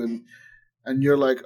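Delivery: ordinary delivery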